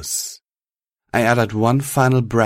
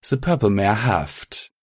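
ordinary delivery

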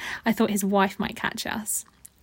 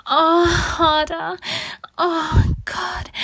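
high, loud, drawn-out moaning plea; passionate cry; 6 semitones higher, 4 dB louder, much slower than usual. Acted. Woman urging on her lover, crying out passionately. Acted love scene, crying out.

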